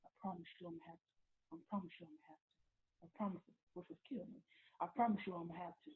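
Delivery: very soft, hushed warning; retold quiet threat. Boyfriend warning her not to tell anybody, retold quiet threat. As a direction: drop the volume right down to threaten.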